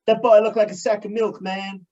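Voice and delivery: mock American commentator voice, lightly done; American accent. Mocking [name]'s commentary in an American accent. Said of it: "That's my American accent".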